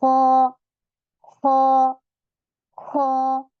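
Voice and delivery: खौ with a full, heavy, rounded alif; heavy khau. Shown as a demonstration. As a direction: Quranic tajweed demo of खौ, heavy. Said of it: "अलिफ भी मोटा बन जाता है".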